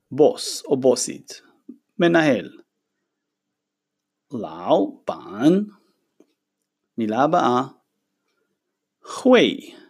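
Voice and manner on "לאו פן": לאו פן said very slowly and lower; slow Chinese demo. Shown as a demonstration. Teacher modeling Chinese for the students, slow. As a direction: draw out לאו פן low and slow.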